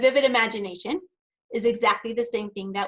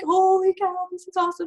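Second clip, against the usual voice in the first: thrilled inner shout; 8 semitones higher, 4 dB louder than usual. Retold or imitated. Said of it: "But I'm like".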